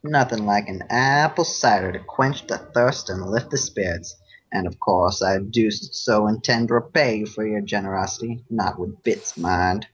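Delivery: a folksy Southern cowpony sweet-talking a stranger; Braeburn southern accent. Acted. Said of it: "uh, southern accent".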